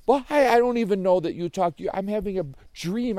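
high, loud, stammered protest; flustered disbelief. Acted. Joey thinks he is dreaming, flustered.

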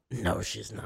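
put-on low, quiet old man's flat denial; flat denial from grandpa. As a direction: mutter it like a confused grandpa. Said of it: "And he just goes".

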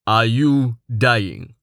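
slow, even, deadpan giant voice; stoic Tallheart. A narrator's reading. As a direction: ask flatly, almost bored. Stoic giant asks Rain.

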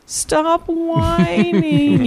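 high, very slow mock whine; whining back. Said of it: "I would whine back at 'em".

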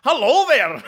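high, hammed-up kilted Scotsman; man in a kilt. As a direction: burst in as a cheery man in a kilt. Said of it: "man in a kilt going like".

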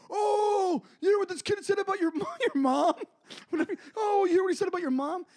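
high, loud, mocking instigator voice; prankster taunt. Prankster stirring up two kids, high loud mocking taunt. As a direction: egg the kid on with glee. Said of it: "He goes".

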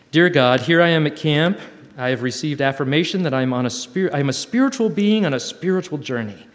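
a sincere prayerful reading; reading a prayer letter. Acted. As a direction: read it like a heartfelt prayer.

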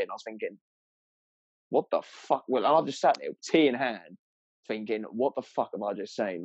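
ordinary talk

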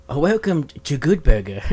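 put-on slow, accented impersonation; hammed-up martial arts star impression; Bruce Lee. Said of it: "You're gonna do Bruce Lee".

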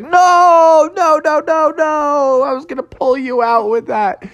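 high-pitched cry of dismay. Acted. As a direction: wail no in exasperation. Froggy sees vine being eaten.

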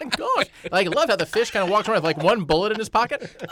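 fast, high, loud gushing; excited. Real, not acted. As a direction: rave about it with delight. Gushing about the fish film.

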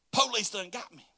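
ordinary talk

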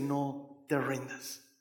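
slow, soft and low, almost breathed; soft whisper. Acted. The voice of Christ urging the listener on, in a slow soft murmur. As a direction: murmur it gently like a comforting presence. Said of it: "hay un suave susurro".

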